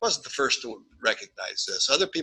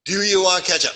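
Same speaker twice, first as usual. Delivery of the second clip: cook asking; 7 semitones higher, 6 dB louder than usual. Retold or imitated. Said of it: "then I'd say".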